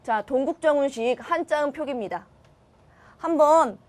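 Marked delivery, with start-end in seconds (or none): none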